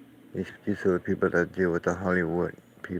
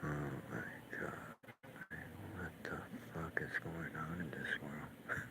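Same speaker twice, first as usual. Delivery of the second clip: hushed disbelief; 3 semitones lower, 14 dB quieter than usual. Real, not acted. Stunned by video clip.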